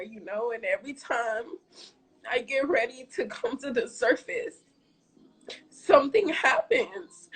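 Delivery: choked-up, high and strained voice; barely holding back tears; tearful. Real, not acted. Streamer tells viewers about her grandpa, choked up.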